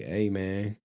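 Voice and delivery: low, soft mock confession; a hint of a glum, low mumbler; imitating a downbeat viewer. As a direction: lightly mimic a guy who is down bad. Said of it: "talking like this to me".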